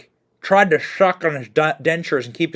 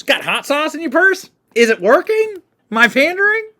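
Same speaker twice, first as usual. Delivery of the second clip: mock Hillary voice; 12 semitones higher, 3 dB louder than usual. Mocking Hillary's hot sauce remark.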